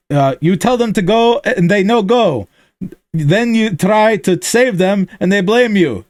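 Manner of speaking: put-on high heavy Russian accent; gruff soldier in broken English; thick Russian accent. Hammer scolds the reporter, in a thick Russian accent. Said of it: "his thick Russian accent".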